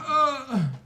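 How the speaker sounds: high, loud startled gasp; sharp gasp. Real, not acted. Player gasping as friend slides down scree.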